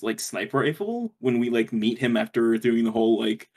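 ordinary talk, nothing marked

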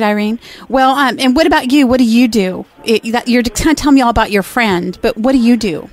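ordinary talk

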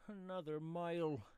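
high, loud wail of complaint; dismayed pedlar. Acted. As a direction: echo it in dismay, pitched well up.

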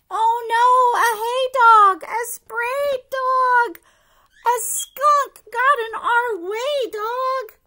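loud, high alarmed reading; excited alarm. Acted. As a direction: cry out in alarm. Skunk surprise in picture book.

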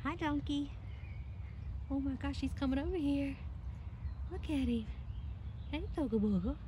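soft, high-pitched greeting to a donkey; talking to an animal. Real, not acted. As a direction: coo gently at an approaching animal.

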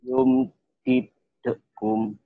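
ordinary delivery

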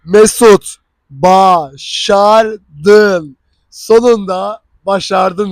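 slow, loud, triumphant self-talk; telling himself he made it, slow and loud; self-congratulation. Retold or imitated. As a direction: savour the win, slowly and loudly. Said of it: "Dedim ki".

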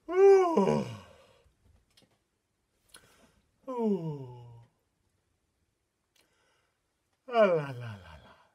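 big yawning vowels sliding downward; sleepy Mister Horse. Pretend. Leader voices sleepy horse for children, mock-yawning. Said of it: "when Mister Horse is very, uh, sleepy".

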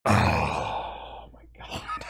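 long, voiceless wheeze of real laughter; helpless amusement. Real, not acted. Viewer losing it at the show, wheezing amusement. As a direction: wheeze it out very slowly, out of air.

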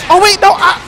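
high, loud cry of alarm; panicked yelp. Real, not acted. Player botching a charged move, panicked yelp. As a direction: yelp as it goes wrong.

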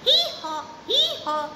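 high braying hee haw; donkey bray. Shown as a demonstration. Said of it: "A donkey says".

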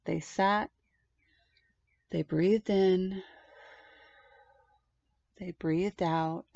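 slow calming storyteller; slow soothing read. A narrator's reading. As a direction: let it slow down with the breath. Calming breathing at story end.

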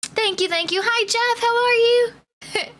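high, playful put-on British accent. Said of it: "I keep having to do an Eng-, a British accent".

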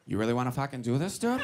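low, soft, quick tough-guy challenge; Mark Wahlberg. Acted. Comic squaring up to a driver as a tough guy, low and quick. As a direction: drop the voice into a hushed threat. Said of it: "I wanted to go full Wahlberg".

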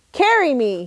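loud bossy demand. Acted. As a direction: order them, loud and pushy. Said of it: "he demanded".

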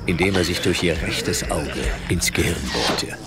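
ordinary delivery

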